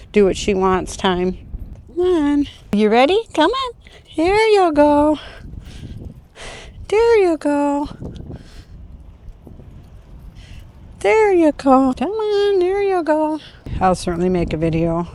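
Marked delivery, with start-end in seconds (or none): 1.9-13.4 high, drawn-out praise for the puppy; high encouraging puppy talk. Real, not acted. Trainer coaxing her puppy onto the grass in high puppy talk. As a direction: gently coax her on in a high voice.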